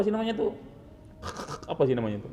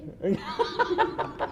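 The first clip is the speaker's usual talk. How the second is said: a short high giggle; high amused laugh; 5 dB quieter than usual. Real, not acted. Teacher laughs at a student's tongue, high giggle.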